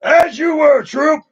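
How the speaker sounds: shouted high drill-sergeant order; commanding officer shout. Acted. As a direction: bark it like an officer dismissing the troops. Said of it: "Isn't that my line?".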